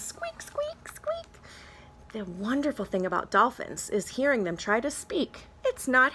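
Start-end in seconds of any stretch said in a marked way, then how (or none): 0.0-1.3 very high dolphin squeak; tiny high dolphin squeaking for the kids. Acted. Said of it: "There are dolphins".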